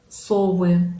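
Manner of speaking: СОВЫ stressed on СО with full O; first-syllable stress. Shown as a demonstration. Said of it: "мы ударение ставим на первый слог".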